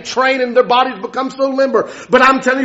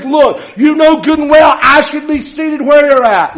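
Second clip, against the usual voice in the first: loud preacher storytelling, voices raised; full-force preaching; 3 semitones higher, 4 dB louder than usual. Acted. Preacher loudly retells Mephibosheth's fear to the church. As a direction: drive the story home to the congregation.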